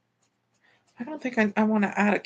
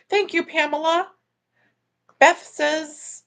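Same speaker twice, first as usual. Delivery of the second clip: ordinary talk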